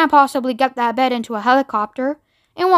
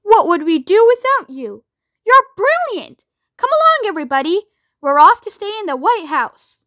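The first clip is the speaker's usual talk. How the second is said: high-pitched, exuberant praise; jubilant, swooping cries; crying out; 7 semitones higher than usual. A narrator's reading. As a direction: exclaim in delight and rally everyone. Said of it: "cried Mister Wonka".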